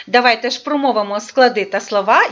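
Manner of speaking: ordinary delivery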